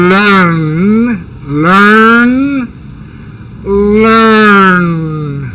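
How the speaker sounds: "learn" drawn out slowly with a clear vowel; demo pronunciation. Shown as a demonstration. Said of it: "Entonces la O". (pronunciación lenta de "learn")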